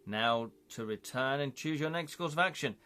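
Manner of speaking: ordinary delivery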